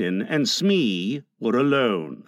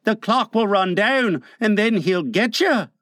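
higher-pitched henchman; a pleading question in a raised character voice; pirate Smee; 5 semitones higher than usual. A narrator's reading. Smee offers his cutlass to Hook, high henchman voice.

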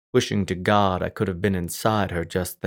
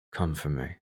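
low, much softer, shaky whisper; trembling whisper; 5 semitones lower, 9 dB quieter than usual. A narrator's reading. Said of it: "I whispered, my own voice trembling now".

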